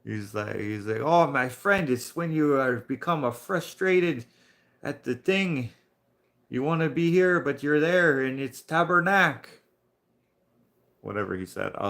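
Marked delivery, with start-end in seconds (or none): 1.0-9.6 higher-pitched imitation of a French-speaking coworker; French accent. Coworker explains a curse word, imitated French accent. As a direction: put on an animated French accent. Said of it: "but I am French".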